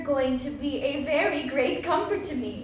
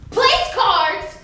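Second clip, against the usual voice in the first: higher, louder disbelief; taken aback by the sight; surprised; 7 semitones higher, 7 dB louder, slower than usual. Acted. Startled discovery at table.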